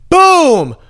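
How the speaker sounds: high, loud booming burst; triumphant exclamation. Retold or imitated. Festivalgoer as bracelet scan turns green, triumphant shout. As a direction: punch it out high and hold it.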